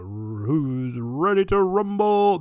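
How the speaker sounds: booming, drawn-out ring announcer call; announcer impression. Mimicking the boxing announcer's famous intro, booming and slow. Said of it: "played by Michael Buffer, the boxing announcer".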